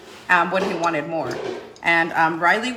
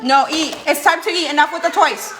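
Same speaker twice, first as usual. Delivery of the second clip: louder, high firm order to eat; firm scold; 8 semitones higher, 7 dB louder than usual. Real, not acted. Mom telling her toddler to stop playing and eat. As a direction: put the toys down and eat, firmly.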